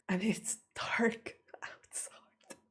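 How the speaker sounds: slow, near-whispered unease; uneasy hushed voice. Real, not acted. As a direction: let it sink to a spooked whisper. Spooked by the dark flat.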